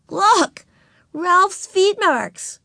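put-on high, loud little-girl excitement; excited exclamation. A narrator's reading. Ramona shows her sister Ralph's feet marks, excited exclamation. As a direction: show off proudly in a high child voice. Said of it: "exclaimed Ramona".

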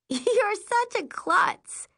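high, slow, giggly teasing; giggling. A narrator's reading. Friend teases the narrator after capsizing, giggly. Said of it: "She giggles".

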